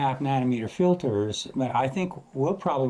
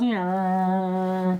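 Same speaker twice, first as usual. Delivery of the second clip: passing-vehicle noise; 6 semitones higher than usual. Shown as a demonstration. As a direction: imitate a train's pitch falling as it passes. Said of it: "when it passes you".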